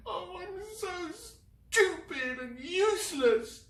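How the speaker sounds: high, slow sobbing cry. Acted. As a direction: sob out self-blame. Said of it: "the tears coming thick and fast".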